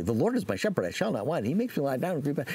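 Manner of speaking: fast, rushed, rote recital; fast recital without meaning; rattled off by rote. Retold or imitated. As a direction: gabble it like a memorized chore. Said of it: "by rote very quickly".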